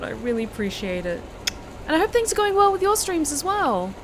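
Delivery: high, bright gushing gratitude; excited thanks. Real, not acted. Thanking a subscriber on stream.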